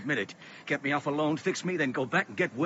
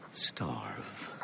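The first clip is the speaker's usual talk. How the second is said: soft low whisper; 10 dB quieter than usual. Acted. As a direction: breathe it out as a verdict. Charles whispers to dying Jed.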